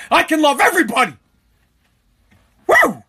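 fast, then a loud high whoop; excited cheer. Real, not acted. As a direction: celebrate like the bars just reopened. Celebrating bars reopening.

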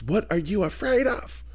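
slow, high Southern lilt; Leslie Jordan impression. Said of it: "He sounded like Leslie Jordan".

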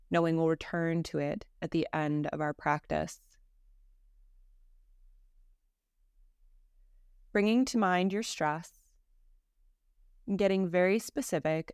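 calm, measured meditation voice with long pauses; guided meditation. Acted. Teacher leading a listener through Yoga Nidra practice, guided meditation. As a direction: guide them unhurriedly, settling each phrase into silence.

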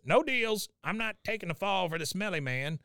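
high-pitched cranky refusal; Cotton. Retold or imitated. Co-host voices Cotton refusing the deal, high and defiant. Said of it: "Cotton says".

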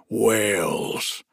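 boastful giant, proud of it. Acted. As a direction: say it with pride. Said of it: "answered the giant proudly".

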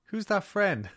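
put-on high little kid voice; child voice. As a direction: ask it like a toddler. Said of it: "and said".

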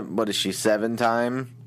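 ordinary delivery

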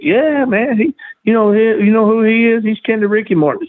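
mock cocky rookie bragging; imitating the braggart. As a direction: puff up like a know-nothing rookie. Retold bragging wannabe wrestler.

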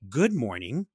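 mock polished phone greeting; professional voice. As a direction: put on a proper customer voice. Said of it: "professional person's voice".

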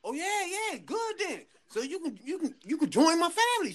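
imitating his surprise, pitch jumping high; pleasantly caught off guard. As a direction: mimic a man startled into an eager, high-pitched yes. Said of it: "like he wasn't expecting that shit".

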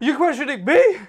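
high, loud Southern mock outrage; hammed-up Southern yell; Southern accent. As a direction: bellow it as an indignant Southern dad. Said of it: "he's becoming more southern".

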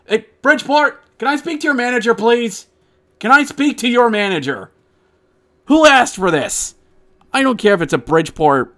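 high, whiny demand for the manager; entitled customer. Pretend. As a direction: play a Karen demanding the manager. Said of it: "mock complaint to Bridgeport".

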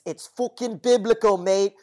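quick mock Cockney boss voice; Alfie Solomons impression. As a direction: growl it out like Alfie Solomons, half joking. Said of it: "I can't do it justice to Josh's fantastic impersonation".

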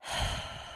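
long, soft audible exhale; deep cleansing breath. Shown as a demonstration. Nurse leading listeners in a breath, cleansing exhale. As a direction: breathe out slowly and fully. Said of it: "let's all take a deep cleansing breath".

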